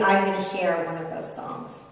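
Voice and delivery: ordinary talk, nothing marked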